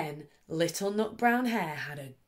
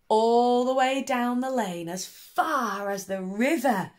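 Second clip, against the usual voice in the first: louder, calling it far; cried out; 4 semitones higher, 6 dB louder than usual. A narrator's reading. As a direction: call it out to the river. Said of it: "cried Little Nut Brown Hare".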